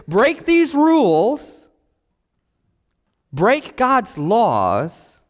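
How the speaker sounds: slow, high, punched preaching; high and slow, hammering the words; emphatic warning. Acted. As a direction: drive each word home slowly, like a warning.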